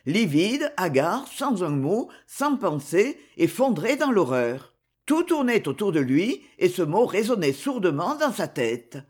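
ordinary delivery, nothing marked

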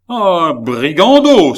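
loud, high, slow outburst of rage; furious cry. Acted. Old baron berating Gilbert, a furious high shout. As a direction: bellow it at the insolent servant. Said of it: "s'écria-t-il".

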